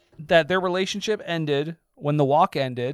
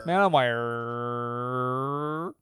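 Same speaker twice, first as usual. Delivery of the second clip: drawn-out mock repeat; 5 semitones lower, 4 dB quieter, much slower than usual.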